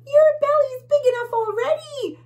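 very high, loud squeak; tiny bug. Acted. Tiny bug mocking the bully's belly, high and loud. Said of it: "says one of the tiny bugs".

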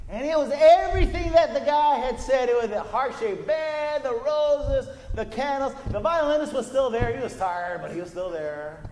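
preacher's full-force climax; preaching at full force. Acted. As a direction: drive the point home to the congregation.

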